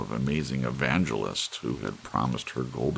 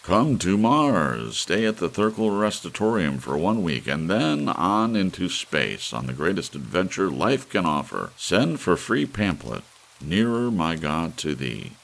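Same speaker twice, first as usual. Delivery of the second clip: louder, higher hammed-up ad announcer; loud advertisement read; 6 semitones higher, 7 dB louder than usual. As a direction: sell it like a booming radio ad. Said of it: "Mister Thurkell's advertisement".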